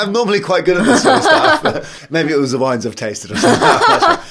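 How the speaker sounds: high, loud laughing speech; embarrassed laugh breaking into the words. Real, not acted. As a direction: talk through real laughter. Laughs at own confusion.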